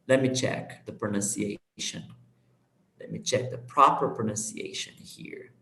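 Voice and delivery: hushed, low, under the breath; near-whispered aside while checking; muttering to self. Real, not acted. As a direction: think aloud softly while looking something up. Muttering while looking up a word.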